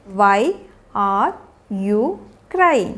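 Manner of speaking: slow, long gaps between single words. Shown as a demonstration. Teacher modelling a question to a crying child, word by word. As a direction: isolate each word of the question.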